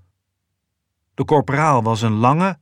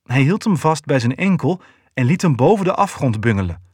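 plain reading voice

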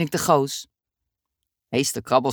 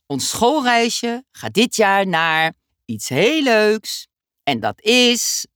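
slow, higher, drawn-out reveal; teasing suspense; 5 semitones higher, 3 dB louder, much slower than usual. Acted. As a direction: stretch it out to keep them guessing. Teacher builds suspense. (traag en geheimzinnig, spanning opbouwend)

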